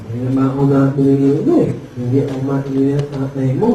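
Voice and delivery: low, quick mock nasal grumble; ngongo customer. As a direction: imitate garbled cleft-palate speech in a low monotone. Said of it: "ngongo din".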